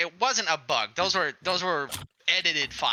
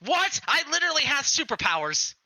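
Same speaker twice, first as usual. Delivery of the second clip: higher, indignant boast; 7 semitones higher than usual. Acted. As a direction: protest like a wronged hero. Playing Apollo boasting.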